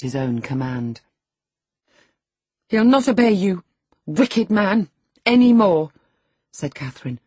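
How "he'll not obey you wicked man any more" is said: high, loud accusation; raised, scornful young woman's voice; defiant. A narrator's reading. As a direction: denounce him boldly at high pitch. She taunts the master.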